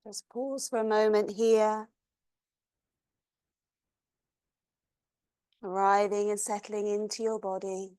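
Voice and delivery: soft, even voice with long pauses; guided meditation. Acted. Yoga teacher leads class through long exhales, guided meditation.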